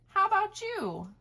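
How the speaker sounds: ordinary delivery, nothing marked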